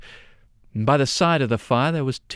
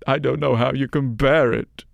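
put-on weepy old woman; 5 dB louder than usual. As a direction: cry it out like a grieving old woman.

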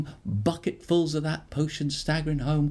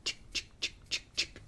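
soft whispered chick chick hiss; chick spray sound; 9 dB quieter than usual. Acted. Boy secretly spraying a scared girl, whispered chick sounds. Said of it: "he'd go".

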